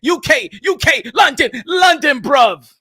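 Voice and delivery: loud, drawn-out shout-out; hype shout-out. Real, not acted. Host loudly plugging a UK boxing channel. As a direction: shout it like a ring announcer.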